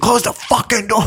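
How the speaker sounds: high, strained mock holler; imitated angry yelling. Busboy to tourists holding door open, mock yelling. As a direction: mimic the furious busboy without real volume. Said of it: "He's yelling at him".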